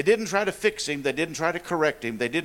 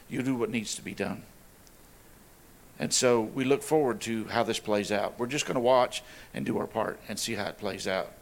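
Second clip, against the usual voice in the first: closing prayer; 5 semitones lower than usual. Acted.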